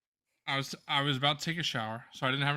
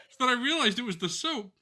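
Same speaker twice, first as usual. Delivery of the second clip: ordinary delivery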